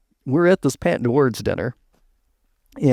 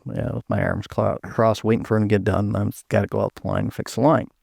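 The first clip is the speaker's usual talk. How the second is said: ordinary delivery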